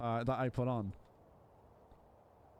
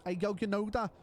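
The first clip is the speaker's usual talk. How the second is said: Scouse accent; 6 semitones higher than usual. Shown as a demonstration. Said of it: "you scouse".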